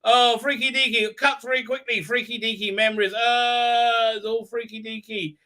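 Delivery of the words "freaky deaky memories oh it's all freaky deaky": ordinary delivery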